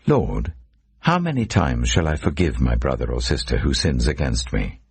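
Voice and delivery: normal talking voice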